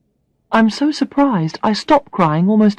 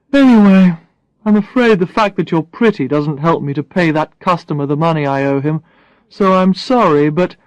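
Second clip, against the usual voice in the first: Brad; 4 dB louder than usual. Acted. Brad voiced by narrator.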